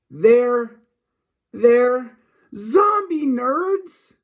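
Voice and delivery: high, very slow, fearful question; scared stammer. Acted. Boy asking his friend in fright, high and slow. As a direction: stammer it out in dread.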